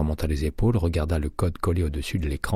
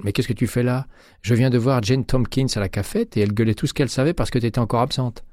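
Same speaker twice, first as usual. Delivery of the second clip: higher-pitched, animated scolding of a friend; exclaiming; 7 semitones higher, 4 dB louder than usual. A narrator's reading. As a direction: burst out with surprise and gossip. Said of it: "s'écria-t-elle". (exclamation étonnée, voix plus aiguë)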